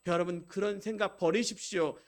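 ordinary talk

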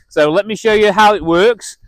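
ordinary talk, nothing marked

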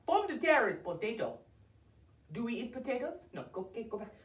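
ordinary talk, nothing marked

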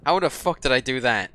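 usual speaking voice

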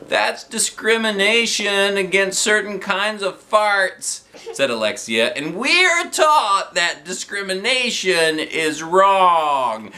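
high, slow, prim protest; indignant girl. A narrator's reading. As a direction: lecture them about fairness. Alexia protests, voiced.

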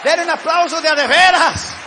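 high, projected demand for applause; rally the crowd to clap; rousing call. Real, not acted.

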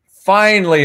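ordinary talk

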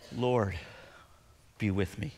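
very soft and slow, breathed out; slow breath prayer. Shown as a demonstration. Pastor modelling a breath prayer for the church, soft and slow. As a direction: let each word ride the exhale. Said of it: "Here's a good breath prayer".

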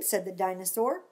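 plain reading voice

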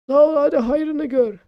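lightly voiced old man blessing; shopkeeper voice.

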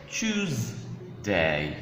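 "Tuesday" split as "Tues... day"; syllable by syllable. Shown as a demonstration. Teacher breaking the word down for learners, slow split syllables. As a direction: draw out "Tues", pause, then "day". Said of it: "how to pronounce days of the week".

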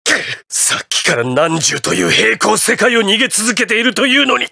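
strained start climbing to a high cry; mounting panic. Acted. Villain realising he is endlessly buried alive, mounting panic. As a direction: build from dismay into desperate shouting.

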